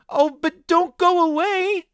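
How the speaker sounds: very high, slow desperate plea; children crying to the girl. Acted. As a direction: beg her not to go. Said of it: "they cried".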